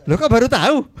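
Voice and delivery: heran sambil tertawa lepas dan keras. Real, not acted. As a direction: tertawakan keanehannya, lebih keras. Menertawakan kritik yang terlambat. (bertanya heran sambil tertawa)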